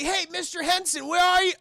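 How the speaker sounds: high searching call. Retold or imitated. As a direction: call out for someone in the building. Acted boy calling for teacher.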